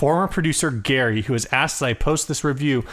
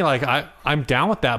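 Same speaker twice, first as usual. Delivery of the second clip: usual speaking voice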